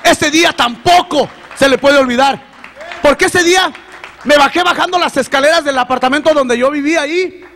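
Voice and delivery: high, loud, fervent testimony; rising, loud pulpit voice; preaching at full force. Acted. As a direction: preach it out to the congregation. Tearful climax of testimony preached.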